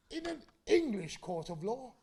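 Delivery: a hint of an indignant judge asking; mock judge. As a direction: sneer it like a pompous judge. Judge scoffing at the barrister.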